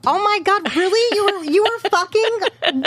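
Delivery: gasping at the discovery, way up high; shocked disbelief. Real, not acted. Host shocked at the editor, high amazed outrage.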